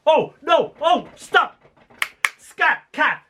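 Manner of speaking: high, staccato cries of alarm; mock shout. Dad shouting at the cat, mock shout. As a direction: shoo it away in high mock outrage. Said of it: "He shouted".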